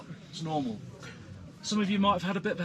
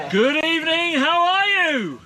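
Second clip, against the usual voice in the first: loud, high rally cry; hype greeting; 10 semitones higher, 9 dB louder than usual. Real, not acted. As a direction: fire up the crowd. Hyping up the group.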